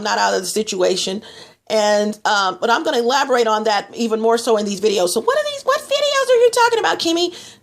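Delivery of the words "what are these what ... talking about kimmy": high-pitched mock viewer asking; viewer's question. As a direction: pipe up as a puzzled viewer, much higher.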